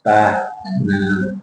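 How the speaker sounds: Ba-na-na said very slowly, beat by beat; syllable by syllable. Shown as a demonstration. Teacher models banana with a rhythm tool. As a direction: tap out each syllable of Ba-na-na. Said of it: "good to use this one".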